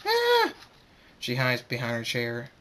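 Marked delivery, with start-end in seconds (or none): 0.1-0.5 very high, loud shriek; terrified scream. A narrator's reading. Fluttershy hears an explosion go off, terrified shriek. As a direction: shriek in sudden fright. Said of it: "Fluttershy screams".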